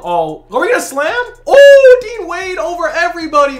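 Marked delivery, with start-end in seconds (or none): 1.4-3.6 loud, high burst of amazement; excited whoop. Real, not acted. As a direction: whoop at a huge play. Reacting to a dunk.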